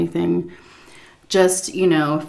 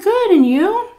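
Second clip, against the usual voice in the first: chirpy reply pitched much higher; sugary small-talk answer; polite reply; 14 semitones higher, 4 dB louder, slower than usual. Retold or imitated. Caregiver answering the daughter-in-law's small talk, chirpy and polite. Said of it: "I said".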